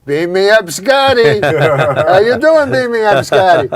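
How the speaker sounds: a doorman calling out a catchphrase in greeting. Retold or imitated. Doorman hailing Norm with his sketch line, a mock loud call. Doorman hails him daily, retold.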